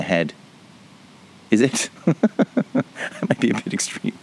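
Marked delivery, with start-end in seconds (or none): 1.9-2.7 high laugh. Real, not acted. Laughs at own joke.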